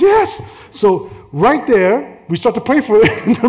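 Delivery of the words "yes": high, loud imitated "yes"; eager acceptance. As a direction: burst out as the eager woman. Imitates woman eagerly accepting prayer.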